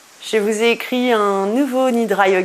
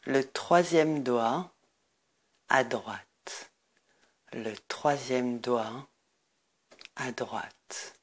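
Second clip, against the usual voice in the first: guided meditation; 4 semitones lower, 8 dB quieter than usual. Acted. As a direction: guide listeners into deep rest, lower and much softer. Leads a Nidra Yoga meditation.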